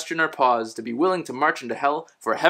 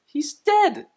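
mock shock; 13 semitones higher than usual. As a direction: jump high on dead. Same punchline read again.

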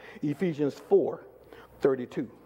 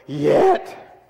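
firm, emphatic single word; raised voice; 2 semitones lower, 4 dB louder than usual. Shown as a demonstration. Preacher leading the reading after asking for volume, raised voice. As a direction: lift the voice on YET. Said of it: "raise your voice up again".

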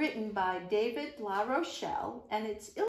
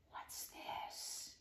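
whisper; 14 dB quieter than usual. Acted. Sneaking past snakes.

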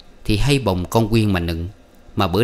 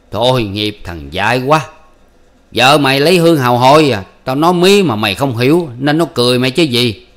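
much louder, pitying scorn; scornful elder; 4 semitones higher, 6 dB louder than usual. Acted. Tam telling Sủ his wife has a lover, scornful. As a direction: blurt out the ugly truth with contempt. Elder blurting the truth loudly.

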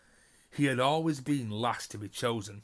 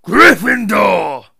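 huge, slow booming shout, much higher; hat shouts; 10 semitones higher, 20 dB louder, much slower than usual. A narrator's reading. The Sorting Hat announces Hannah's house with a booming shout. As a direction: bellow the house name to the whole hall. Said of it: "shouted the hat".

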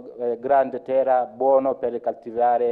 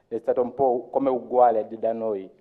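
ordinary talk

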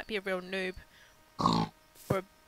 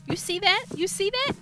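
high, loud eager question; urgently pointing it out; wide-eyed excited repeat; 9 semitones higher, 5 dB louder than usual. Real, not acted.

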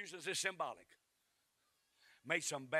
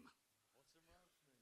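put-on child's faint question; 34 dB quieter, much slower than usual. Shown as a demonstration. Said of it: "Oh, ask him".

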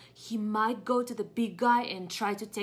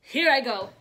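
determined; 3 semitones higher, 11 dB louder than usual. Acted. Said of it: "really just determined".

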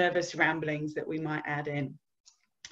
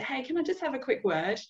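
light, higher-pitched casual request; discreet approach; 7 semitones higher, 3 dB quieter than usual. Acted. Manager pulling an employee aside for feedback, light casual approach. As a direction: pull someone aside with a high, easy opener. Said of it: "pull them aside and say".

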